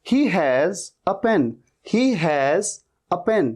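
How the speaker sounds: He has a pen, each word drawn out slowly; teacher dictating slowly. Shown as a demonstration. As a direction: spell it out slowly. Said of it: "हैज नहीं".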